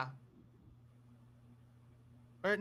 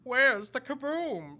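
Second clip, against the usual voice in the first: slow, high, louder alien voice; Marvin the Martian voice; 7 semitones higher, 9 dB louder, slower than usual. Shown as a demonstration. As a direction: drawl it like a cartoon Martian. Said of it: "a more out there voice like".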